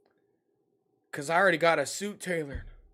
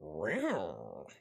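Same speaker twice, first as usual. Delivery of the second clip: strange, lower-pitched cat meow; odd cat sound; 6 semitones lower, 7 dB quieter, much slower than usual. Shown as a demonstration. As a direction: try out a different cat voice. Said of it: "What if I sounded like that?".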